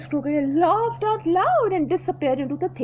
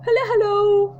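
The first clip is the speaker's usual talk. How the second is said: much higher, louder hippo greeting; cheery hippo; 7 semitones higher, 7 dB louder than usual. Acted. Hippo hailing the crocodile, cheery loud. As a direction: call out a big friendly hello.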